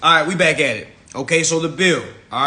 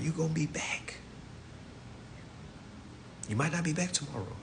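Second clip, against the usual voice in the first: whispered; 4 semitones higher, 9 dB quieter than usual. Real, not acted. Quiet warning.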